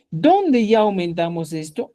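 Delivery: normal talking voice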